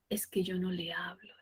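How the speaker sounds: whispered shy excuse; timid whisper. Retold or imitated. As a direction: whisper it like a shy, embarrassed mother. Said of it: "decía". (susurro tímido de la mamá)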